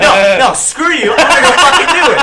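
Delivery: mock defiant yell. Retelling defying Terry, laughing mock yell.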